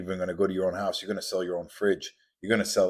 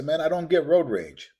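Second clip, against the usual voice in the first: high-pitched, louder mock protest; objecting listener; 9 semitones higher, 5 dB louder than usual. Listener denying he has road rage, mock defensive. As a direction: imitate someone protesting, pitch pushed up.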